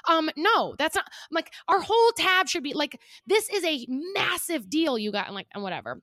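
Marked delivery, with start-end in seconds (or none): 0.1-1.0 high, loud indignant refusal; retold frustration. Diner retelling her reply to the manager, frustrated. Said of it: "I was just really frustrated". 1.7-5.3 high, loud indignant protest; retold frustration. Diner retelling her demand to the manager, frustrated. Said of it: "I was just really frustrated".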